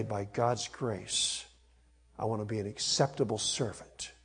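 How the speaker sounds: quiet prayerful vow. Pretend. Preacher voicing the believer's quiet vow to serve, softly. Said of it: "and to say".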